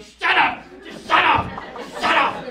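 loud sharp yell of "Shut up!". Retold or imitated. Husband yelling at the barking dog to shut up, loud. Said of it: "I'm yelling".

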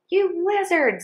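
high-pitched angry yell; scream. A narrator's reading. The biggest guard screaming at the worker on the seat. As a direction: scream the insult at the thief. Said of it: "screamed the biggest guard".

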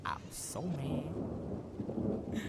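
very slow, drawn-out grumble; very slow mad. Real, not acted. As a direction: drag the words out in sulky anger. Angry after dying.

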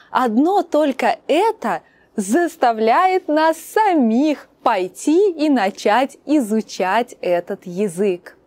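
usual speaking voice

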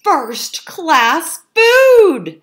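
high, loud, very drawn-out praise; delighted exclamation. A narrator's reading. Fox praising the cook's feast, loud delighted exclamation. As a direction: savour each word in a loud cry.

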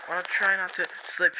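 usual speaking voice

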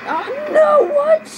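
loud, high-pitched alarmed cry; startled outburst. Real, not acted. Player reacting to sudden trouble in the game, startled. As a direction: cry out in shocked disbelief.

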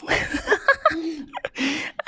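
a silly high-pitched laugh; squeaky high laugh. Acted. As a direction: giggle in a very high cartoon voice. Dad laughs in his put-on voice.

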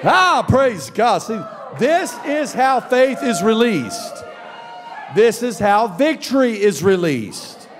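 fiery sermon shout; preaching at full force. Acted. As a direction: preach it out to the room.

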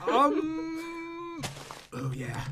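unintentionally creepy, hushed tail. Real, not acted. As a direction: draw out the um, then drop to a creepy murmur. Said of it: "Why did that sound so creepy?".

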